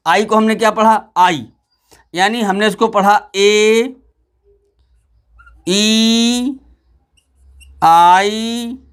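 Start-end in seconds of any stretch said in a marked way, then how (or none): 3.4-8.8 ए, ई, आई each held long with gaps; long vowel sounds. Shown as a demonstration. Teacher recaps pronunciation for pupils, long vowel sounds. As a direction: draw every vowel out separately. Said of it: "यही इसका उच्चारण है".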